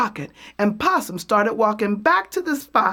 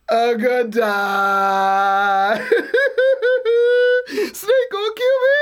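hollering; 2 semitones higher, 4 dB louder, slower than usual. Acted. Possum walking along hollering that Snake will kill him. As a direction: wail it out in comic despair.

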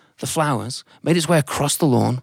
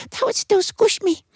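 very high tiny voice; a tiny ant politely refusing; squeaky modest no. Acted. Ant declines.

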